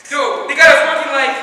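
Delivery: loud, high-pitched outburst; flipped out. Retold or imitated. Comic acting a mad pyramid builder, flipping out. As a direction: blurt the protest high and loud. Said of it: "Just flipped out and was like".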